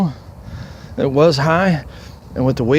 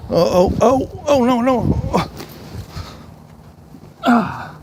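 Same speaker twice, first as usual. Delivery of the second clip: real alarm as the sled slides; panicked; 9 semitones higher than usual. Real, not acted. Losing control on the sled.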